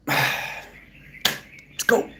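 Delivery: breathy sigh, then a whispered let's go. Real, not acted. Streamer deflating after his cheer, sighed whisper. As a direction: let the air out and breathe it.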